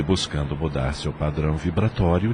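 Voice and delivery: ordinary talk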